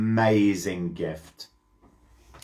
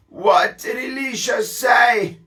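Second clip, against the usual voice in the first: eager king; 9 semitones higher than usual. Acted. King eagerly asks Chazael.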